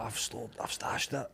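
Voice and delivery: whispered and very soft; whispered secret.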